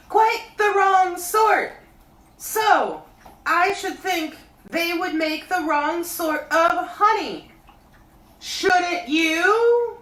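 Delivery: high, loud bear calling down; Pooh voice. A narrator's reading. Pooh calling down to Christopher Robin, high bear voice. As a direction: call up from the honey tree like a bear. Pooh about wrong honey.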